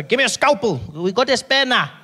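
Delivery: loud, high frantic surgeon; frantic doctor. Retold or imitated. Doctor barking at staff in a shoddy theatre, loud and high. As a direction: yell for tools like a panicked doctor. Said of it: "There's the doctor".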